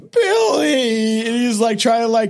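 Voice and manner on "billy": shrieked name, higher; high cry of Billy. Retold or imitated. Retelling the movie scene, a high cry of Billy.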